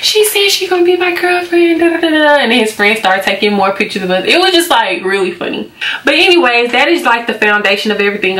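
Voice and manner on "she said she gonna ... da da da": high, sing-out mock crowing; imitating an overjoyed boyfriend boasting; excited announcement. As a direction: announce it to everyone, pitched way up. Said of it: "he got so excited".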